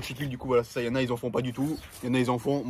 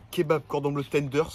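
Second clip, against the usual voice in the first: ordinary delivery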